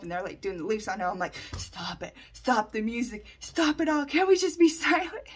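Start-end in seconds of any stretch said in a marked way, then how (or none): 1.6-5.3 building plea. Retold or imitated. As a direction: start hushed and climb to a mock desperate cry. Retold plea during ceremony.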